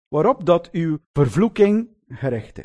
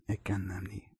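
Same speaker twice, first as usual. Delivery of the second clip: much softer, low sworn denial; denial under oath; 10 semitones lower, 16 dB quieter than usual. Retold or imitated. Preacher voicing Peter swearing by God, hushed and low. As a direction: murmur it like a grim oath. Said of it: "je noemt de naam van God en zegt".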